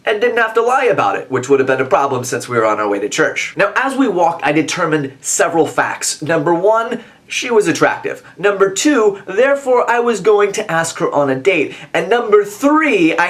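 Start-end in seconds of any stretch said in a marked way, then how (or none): none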